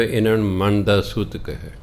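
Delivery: ordinary delivery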